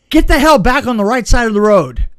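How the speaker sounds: loud, sudden yell cutting off the daydream; mock warning shout. Advising the visiting director, a warning yelled out. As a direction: snap him back with a shout. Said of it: "I said".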